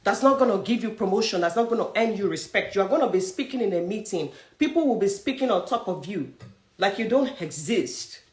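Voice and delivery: usual speaking voice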